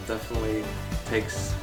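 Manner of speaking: usual speaking voice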